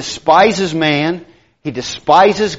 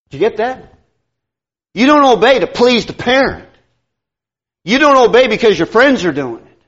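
ordinary talk

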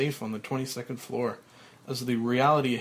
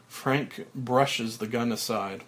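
normal talking voice

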